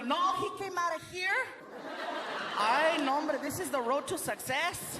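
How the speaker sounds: high put-on mom voice; proud mother. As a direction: boast to everybody as his mother. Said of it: "And she tells everybody".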